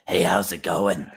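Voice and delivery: slow raspy smoker's voice. Pretend. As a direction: talk like a lifelong smoker. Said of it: "in a permanent smoker's voice".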